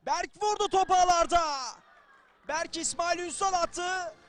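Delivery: loud, high goal yell; screaming football commentator. Real, not acted. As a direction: roar the late goal. Late goal, commentator shouts.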